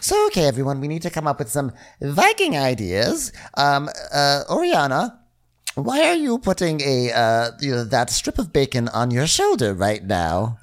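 high put-on boss running a meeting; Faye impression voice. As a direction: lead the staff in a high mock Faye voice. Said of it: "She's like".

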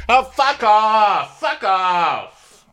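slow, very high, loud yell of fury; mock rage, bellowed across the water; furious shout. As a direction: scream it at the neighbors, dragged out. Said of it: "hear me say".

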